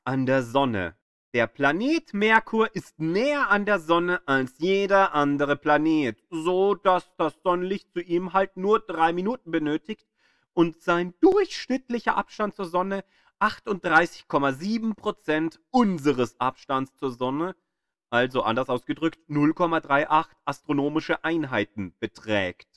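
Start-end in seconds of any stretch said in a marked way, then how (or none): none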